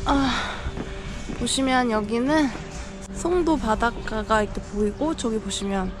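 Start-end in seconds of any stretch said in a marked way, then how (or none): none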